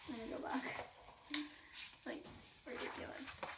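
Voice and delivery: unvoiced, whispered remark; whispered aside. Real, not acted. Aside about the yard signs.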